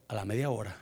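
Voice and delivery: low, soft storytelling aside; hushed suspense. Real, not acted. Speaker setting up the bad news from the radio, hushed. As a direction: drop low and quiet, setting up trouble.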